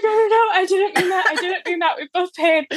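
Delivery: high, laughing, half-crying panic; blurting out a flustered correction through laughter; panicked protest. Retold or imitated. Fan panics at the actor over who paid, laughing high protest. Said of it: "like, panicking".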